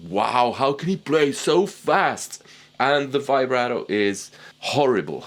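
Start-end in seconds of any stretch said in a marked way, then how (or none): 0.1-2.4 lightly voiced awed listener, slower; a mild mock gasp of wonder, slow; a touch of an amazed fan; impressed listener. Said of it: "everything sounds like".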